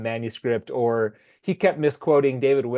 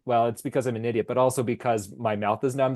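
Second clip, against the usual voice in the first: ordinary delivery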